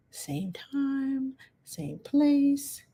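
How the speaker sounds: whispered, high and airy; soft whispered catchphrase; whispered sign-off. Real, not acted. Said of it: "sign-off".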